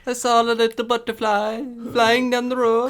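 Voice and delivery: very high, loud squeaky voice; bad Italian accent.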